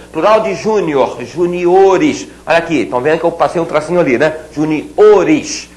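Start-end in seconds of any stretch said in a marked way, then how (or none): 1.2-2.3 "juniores" stressed on the O, ju-ni-O-res; "juniores" with the stress on O, not on JU; stress moved forward. Shown as a demonstration. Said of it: "A sílaba tônica avança". 4.5-5.7 "Juniores" stressed on the O; ju-ni-O-res, leaning on the O in "Juniores"; correct stress demo. Shown as a demonstration. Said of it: "Essa é a pronúncia".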